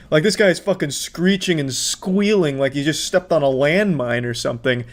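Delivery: normal talking voice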